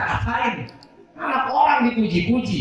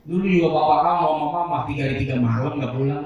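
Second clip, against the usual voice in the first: gossiping mother; 6 semitones lower, faster than usual. Retold or imitated. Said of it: "Malah ngegosip".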